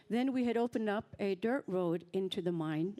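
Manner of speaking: ordinary talk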